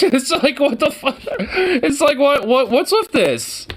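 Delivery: ordinary delivery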